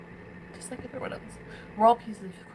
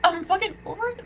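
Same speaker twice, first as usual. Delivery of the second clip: higher-pitched sarcastic pretend claim; mock over it; 6 semitones higher than usual. Voicing what others want her to say, sarcastic. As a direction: mimic the lie they want, pitched up. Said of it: "You want me to fucking say".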